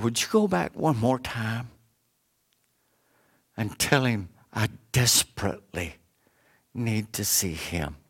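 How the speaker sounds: understated, hushed begging with pauses; tearful plea. Retold or imitated. Poor pastor begs the maid to go back, understated tearful plea. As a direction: softly imitate a tearful, halting plea. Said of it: "With tears in his eyes".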